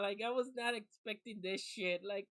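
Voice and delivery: ordinary talk, nothing marked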